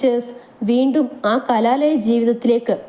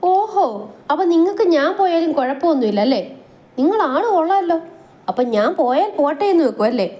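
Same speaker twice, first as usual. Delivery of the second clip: put-on higher, sulky and indignant young wife; mock offended wife; 7 semitones higher than usual. Anu scolds her husband Abhi, higher and indignant. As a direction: pout and act hurt at the husband.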